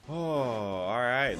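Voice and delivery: ordinary delivery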